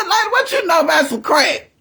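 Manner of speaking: loud and high, laughing through it; teasing in disbelief; teasing grandma while laughing; laughing retort. Retold or imitated. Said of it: "I said".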